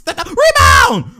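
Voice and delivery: high, loud yell at the screen; exasperated yell. Real, not acted. Fan yells at players over a rebound, exasperated shout.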